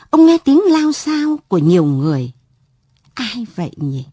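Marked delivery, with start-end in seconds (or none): none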